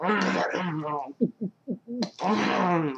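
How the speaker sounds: imitated greedy munching noises; mock snorting, wordless chomping; pig-like gobbling. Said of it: "like a pig".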